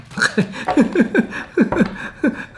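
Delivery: long high chuckle; laugh. Real, not acted. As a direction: burst into a run of giggles.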